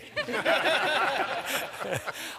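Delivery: high, delighted burst of laughter; cosmic laughter. Retold or imitated. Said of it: "I call that cosmic laughter".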